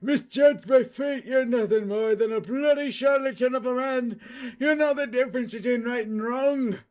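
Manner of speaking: high, loud cry of pain; old drunk shouting in pain. Acted. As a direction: shout it out in agony, desperate to be heard. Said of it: "the drunk is shouting it in pain".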